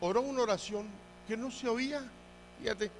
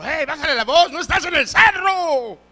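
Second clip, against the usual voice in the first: high, loud mock scolding from mom; raised-pitch exasperated mom shout; scolding mother; 10 semitones higher, 8 dB louder than usual. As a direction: imitate a mother yelling at her noisy kid. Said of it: "mi mamá me decía de chico".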